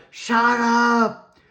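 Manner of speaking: high, loud retold yell; frantic reenacted yelling to silence Alexa; yelled shut up. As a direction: shout it over the chatter. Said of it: "and I was going".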